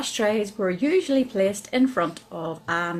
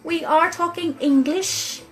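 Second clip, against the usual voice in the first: higher, emphatic mock protest; indignant retort; 7 semitones higher, 3 dB louder than usual. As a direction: insist on it, exasperated, as in a retold thought. Retold indignant thought at servers.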